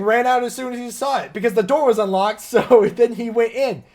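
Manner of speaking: usual speaking voice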